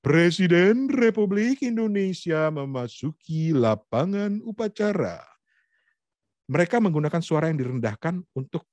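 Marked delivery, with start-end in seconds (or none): 0.0-5.2 grand, slow, sustained announcer voice; ceremony MC. Shown as a demonstration. MC announces the President at an Independence Day ceremony, stately proclamation. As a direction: proclaim it with long stretched vowels and raised pitch. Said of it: "M C-nya kan begitu ngomongnya".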